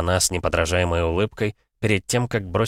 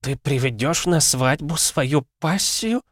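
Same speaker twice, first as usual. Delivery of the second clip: crestfallen; 9 semitones higher, slower than usual. A narrator's reading. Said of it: "упавшим голосом произнесла Лариса".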